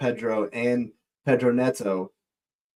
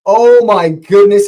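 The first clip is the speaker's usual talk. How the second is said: slow, loud, drawn-out oh; slow, loud disbelief; stunned gasp; 7 semitones higher, 11 dB louder, slower than usual. Real, not acted. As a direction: groan at the near miss. A near miss, live.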